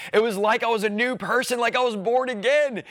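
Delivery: high-pitched, awed exclamation; fervent testimony. Real, not acted. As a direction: testify with rising wonder, pitched well up. Testimony of conversion, rising fervor.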